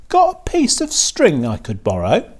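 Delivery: Wilbur the pig. A narrator's reading. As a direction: ask the rat politely in the pig's voice. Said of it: "asked Wilbur".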